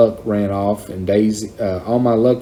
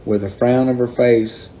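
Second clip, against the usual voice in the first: ordinary delivery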